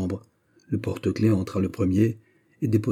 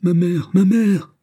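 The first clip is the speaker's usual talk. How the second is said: slow, much higher, loud wail; anguished cry; 10 semitones higher, 7 dB louder, slower than usual. A narrator's reading. Young prisoner sees the governor, cries for mother. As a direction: cry out for his mother in despair. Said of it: "se mit à crier".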